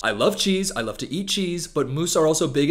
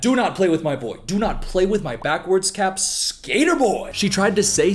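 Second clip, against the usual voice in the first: usual speaking voice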